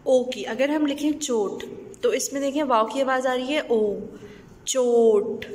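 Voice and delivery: normal talking voice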